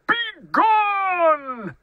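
high, drawn-out roar of an angry bear. A narrator's reading. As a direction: bellow the order, stretching the last word. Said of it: "roared Bear".

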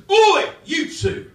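loud, slow hard-man shout; mock gangster yell; Ross Kemp hard man. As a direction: bark it like a TV tough guy. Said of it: "But I'm so tough".

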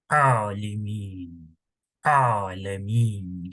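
ALIMIN, ALEMIN drawn out from a throaty ayn; throat letter. Shown as a demonstration. Teacher giving examples of ayn, long throaty Alimin, Alemin. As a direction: squeeze the opening A in the throat and stretch it. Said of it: "Bu da boğaz harflerinden".

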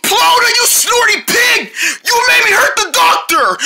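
high furious shouting; angry yelling. Acted. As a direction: scold her at full pitch. Angry at friend.

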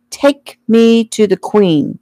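slow, high-pitched royal order; commanding. A narrator's reading. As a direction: command it like a child playing king. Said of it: "Joey commanded".

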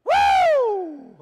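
huge high whoop of praise; Southern hallelujah whoop. Shown as a demonstration. Preacher leading the crowd, a high joyful whoop. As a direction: let out a joyful shout to the Lord. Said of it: "Southern hallelujah".

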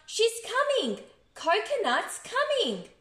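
plain reading voice

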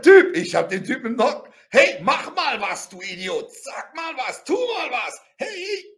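re-enacted exasperated calls, climbing high in pitch; mock shouting, stretched and rising; retold yelling. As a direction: yell at a useless guard, half in earnest. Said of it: "und ich schreie dann".

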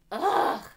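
loud, high exasperated groan; frustrated groan. A narrator's reading. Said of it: "I groaned".

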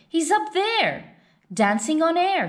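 put-on a boy pointing at the sky, thrilled; excited child. Max showing his brothers the dragon, excitedly high.

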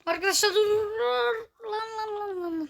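high panicked cries of stop; yelping in alarm at a near crash. Real, not acted.

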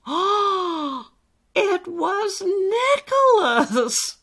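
high, slow, loud exclamation; gasp, then draw out the happy reveal; delighted surprise. A narrator's reading. Alistair discovers the voice is his friend, delighted.

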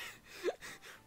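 a quiet squeak of a laugh; soft, high breathy giggle. Real, not acted. Relieved laughter.

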